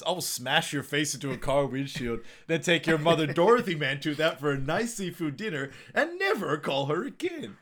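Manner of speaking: imitating a hot-headed tirade; mock angry threat. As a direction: build into a high, indignant threat. Acted threat from film character.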